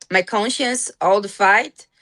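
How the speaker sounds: ordinary delivery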